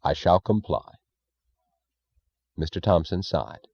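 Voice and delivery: ordinary delivery, nothing marked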